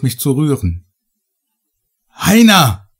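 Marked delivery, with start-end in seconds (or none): 2.1-2.9 much higher, louder desperate yell; bellowing his name. Acted. Narrator bellows for his companion into the red light, desperate yell. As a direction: roar the name into the void. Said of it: "brüllte ich in das flirrende rote Licht".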